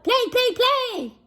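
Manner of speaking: very high, bouncy begging; excited baby goose. Acted. Excited gosling to Jack, very high. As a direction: beg to play, thrilled. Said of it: "cried the excited baby goose".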